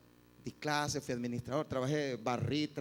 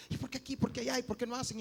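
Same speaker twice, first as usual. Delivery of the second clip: fault-finding; 7 semitones higher, faster than usual. Acted. Embittered churchgoer finds fault.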